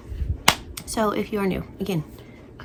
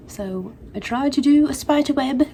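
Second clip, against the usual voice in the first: put-on higher, lively English accent; 5 semitones higher, 3 dB louder than usual. Crafter showing her spider web hair, in an English accent. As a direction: slip into a playful English accent. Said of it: "I don't know why I'm English all of a sudden".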